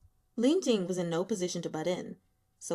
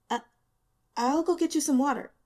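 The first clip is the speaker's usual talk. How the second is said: eager halting offer, a touch louder; stammering ingratiatingly; 6 semitones higher, 6 dB louder, faster than usual. A narrator's reading. Nervous junior offering the girl water, ingratiating stammer. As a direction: stumble over it, eager to please. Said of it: "stammered ingratiatingly".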